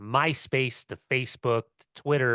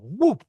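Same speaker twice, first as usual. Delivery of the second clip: mock whoop of a fall; 9 semitones higher, 6 dB louder than usual. Imitating being cartwheeled.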